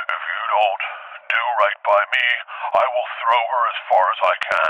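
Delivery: low, deliberate creature threat; menacing elephant voice. Retold or imitated. Mama elephant threatening the teller's daughter, low menace. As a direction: pause between phrases like a villain.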